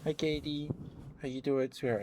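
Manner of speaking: high, sweet greeting; excited greeting. Real, not acted. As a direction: greet a friend warmly and brightly. Greeting a viewer on stream.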